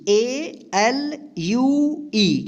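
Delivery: spelling out each letter very slowly. Shown as a demonstration. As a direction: drag out the letters for the class. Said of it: "क्या होगा?". (धीमी spelling)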